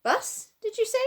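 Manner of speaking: a deaf man shouting back a question; deaf man's shout. A narrator's reading. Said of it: "shouted the Saucepan Man".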